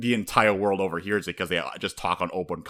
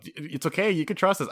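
mock coaxing; 9 semitones higher than usual. Coaxing fan, higher.

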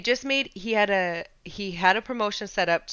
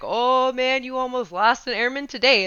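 retold excited news; 6 semitones higher, 5 dB louder than usual. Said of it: "we're like".

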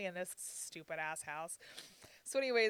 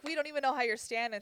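higher, louder and fast; alarmed staff; 6 semitones higher, 5 dB louder, faster than usual. Retold or imitated. Clinic staff amazed the patient is on her feet, fast and loud. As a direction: rattle it off in alarm.

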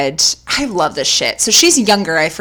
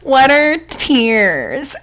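mock deadpan; 6 semitones higher, much slower than usual. Mocking unemotional friend.

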